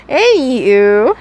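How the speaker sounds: slow sing-song anywho, rising; drawn-out anywho. Real, not acted. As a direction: stretch anywho out playfully with a rise. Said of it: "Anywho, uh".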